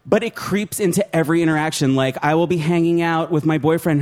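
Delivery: ordinary talk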